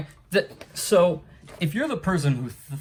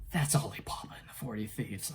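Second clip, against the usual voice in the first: whisper; 4 semitones lower, 9 dB quieter than usual. Real, not acted. Muttered aside while reviewing.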